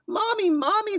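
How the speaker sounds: high, loud scared cry for mom; frightened cry. Acted. Scared twins running to mom in the storm, crying out. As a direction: call out for mommy in fright. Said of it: "they cried as they ran to her".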